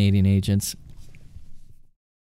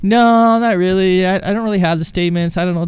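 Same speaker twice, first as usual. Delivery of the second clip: reluctant customer; 6 semitones higher, 5 dB louder than usual. Retold or imitated. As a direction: whine lightly like an evasive customer. Customer refusing, acted.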